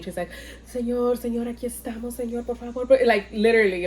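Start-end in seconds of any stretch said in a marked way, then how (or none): 0.7-2.8 higher, softer pleading prayer in Spanish; mock urgent Spanish praying; praying mom. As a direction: imitate a Mexican mom begging God. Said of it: "My mom's praying".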